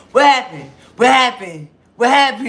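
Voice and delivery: loud, high, in-your-face demanding; drunk woman's pushy questioning. Retold or imitated. Drunk woman at liquor store pestering them, loud and high. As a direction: bark it right in someone's face like a drunk stranger. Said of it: "That's how she's talking".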